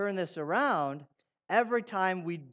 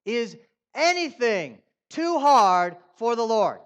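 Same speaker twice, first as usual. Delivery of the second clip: slow, loud challenge stressing hard; preaching with force; 4 semitones higher, 9 dB louder, slower than usual. Shown as a demonstration. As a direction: demand an answer from the crowd. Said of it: "Is that how you feel?".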